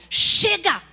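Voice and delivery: put-on loud, sharp scold at a child; angry shout of "Chega!" at a toddler. Shown as a demonstration. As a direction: snap a stern "Chega!". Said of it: "não adianta você falar assim pra criança".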